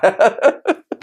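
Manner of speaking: a quick high laugh. Real, not acted. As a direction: laugh at the bit. Laugh after voiced client. (risa aguda breve)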